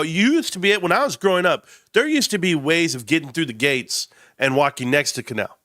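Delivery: ordinary talk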